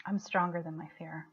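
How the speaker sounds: hushed affirmation. Shown as a demonstration. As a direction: say it quietly as if to yourself. Intimate affirmation in the round.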